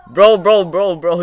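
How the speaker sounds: loud, high repeated "bro"; yelling as the ball rolls round the rim; excited shouting. Real, not acted. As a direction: call it out in hype. Reacting to ball rolling rim.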